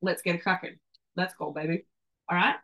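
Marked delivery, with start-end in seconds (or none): none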